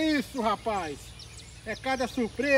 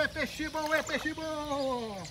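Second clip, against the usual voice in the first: softer, long-held fishing call; drawn-out catchphrase; 8 dB quieter, much slower than usual. Real, not acted. Angler as the fish nears the screen, drawn-out call. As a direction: let the last word trail out long.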